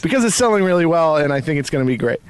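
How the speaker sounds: loud and excited. Real, not acted. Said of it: "I'm being loud".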